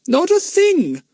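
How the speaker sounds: high indignant squeak; emphatic denial. Acted. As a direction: deny it in a high-pitched, emphatic voice. Mister Smelly answers emphatically.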